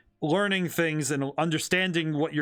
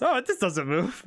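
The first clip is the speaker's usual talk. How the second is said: imitating own puzzled remark; mock clueless voice. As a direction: parrot yourself, amused and dopey. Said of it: "can't stop laughing about it".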